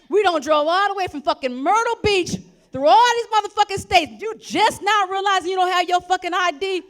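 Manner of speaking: loud, high outraged shout; yelling at her friend for forgetting her ID; furious yell. Retold or imitated. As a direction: blow up at her in disbelief.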